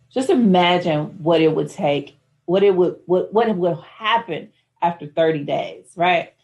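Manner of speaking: ordinary talk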